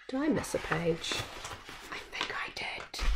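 soft muttered aside, then whispered; an embarrassed reader to herself; quiet aside. Real, not acted. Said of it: "reader aside".

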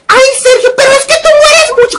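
very high put-on squeaky voice; sing-song high-pitched mockery of a critic; mock complaining viewer. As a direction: mimic a nagging commenter way up in falsetto. Mimicking a viewer's whiny complaint.

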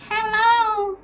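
a comic high-pitched dark lord; Voldemort impression. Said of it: "This is me impersonating Voldemort".

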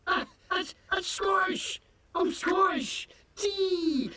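high, pushed protest in a fake Scottish accent; squeaky fake Scottish indignation; indignant insisting. Defending his fake accent.

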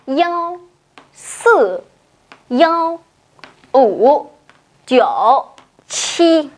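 digits read one by one in Mandarin, with yao for one; Chinese digits. Shown as a demonstration. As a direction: spell out each Mandarin digit slowly. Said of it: "trong tiếng Trung cô phải đọc như thế nào".

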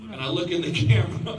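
usual speaking voice

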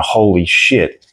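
drawn-out, slow shocked reaction; lightly acted astonishment, slowly; mock freak-out. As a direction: imitate stunned buyers, stretching the words. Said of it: "people were freaking out going".

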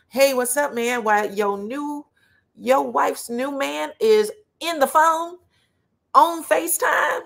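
high, drawn-out mock astonishment; mock incredulous greeting. Acting out a mock greeting to husband.

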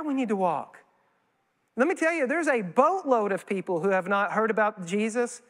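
ordinary delivery

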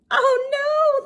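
high, loud pretend alarm; mock dismay. Teacher mock-alarmed at the children in the bathtub. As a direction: gasp it out for the kids.

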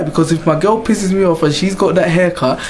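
usual speaking voice

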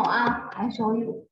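ordinary talk

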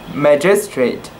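ordinary delivery

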